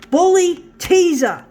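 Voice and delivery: explode, spitting each word at him; furious shout. Real, not acted. Suddenly yells the words at dad.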